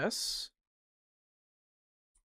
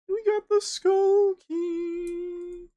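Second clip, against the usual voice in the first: much higher and slow, drawn-out chant; sing-song announcement; 4 dB louder, much slower than usual. Real, not acted. Finding the skull key in the game, a slow high sing-song. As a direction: sing it out like a little victory.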